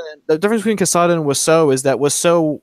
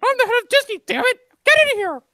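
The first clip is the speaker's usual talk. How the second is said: high squeaky Mickey scolding; berating cartoon mouse boss; angry boss. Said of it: "do an interaction right now".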